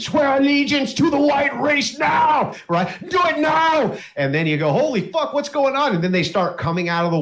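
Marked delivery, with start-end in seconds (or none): 0.0-4.0 high, shouted fanatical demand; screeching zealot. Acted. Neighbor turns on newcomer demanding allegiance, screeching. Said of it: "they're just screeching this shit at you". 4.7-5.9 high-pitched acted alarm; shocked newcomer. Newcomer reacts to the screeching locals, shocked.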